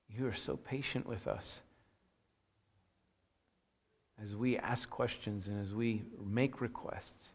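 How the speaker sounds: quiet pastor's prayer. Acted. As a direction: pray it quietly and reverently. Opening prayer before the sermon.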